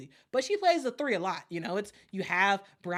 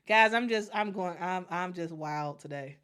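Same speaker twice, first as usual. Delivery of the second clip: usual speaking voice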